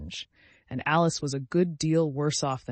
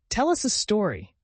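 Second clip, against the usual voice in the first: a higher, louder, urgent request; keen, raised demand for a tale; eager demand; 8 semitones higher, 6 dB louder than usual. A narrator's reading. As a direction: push them for the story. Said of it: "said the March Hare".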